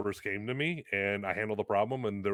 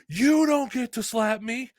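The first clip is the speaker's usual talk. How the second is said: high, loud, slow indignant yell; mock yell; 6 dB louder, slower than usual. The kid yelling back after being slapped, loud and high. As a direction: shout it back, outraged. Retold opponent yelling at him.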